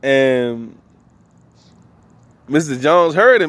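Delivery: ordinary talk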